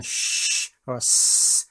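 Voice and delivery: "sh" and "s" as long sustained hisses; "sh" and "s" held as pure consonant noise; hissed noise demo. Shown as a demonstration. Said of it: "sound will be fed into".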